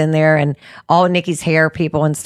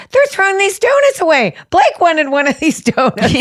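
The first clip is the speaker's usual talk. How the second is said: much higher, dismayed retelling; appalled protest; 10 semitones higher than usual. Acted. Telling how she protested the donuts being thrown out, appalled. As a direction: pitch it way up in mock outrage.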